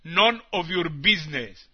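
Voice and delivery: ordinary talk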